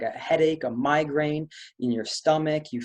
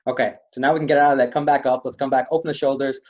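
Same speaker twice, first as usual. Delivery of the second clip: ordinary talk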